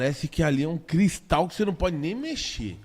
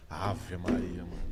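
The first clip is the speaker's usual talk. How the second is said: usual speaking voice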